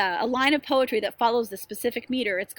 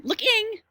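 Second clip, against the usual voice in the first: look-ING, ING pushed up high; mis-stress "looking" on its last syllable, ING; stress on wrong syllable; 13 semitones higher, 4 dB louder than usual. Shown as a demonstration. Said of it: "emphasizing the wrong syllable".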